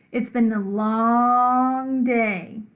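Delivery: slow, drawn-out tired sigh of a mom; weary mom. Acted. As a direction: drag it out like a worn-out mother.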